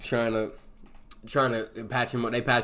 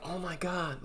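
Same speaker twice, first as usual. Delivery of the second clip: higher, hushed shocked gasp; gasping fright; 8 semitones higher, 10 dB quieter than usual. Retold or imitated. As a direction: gasp at the fall. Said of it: "it was like".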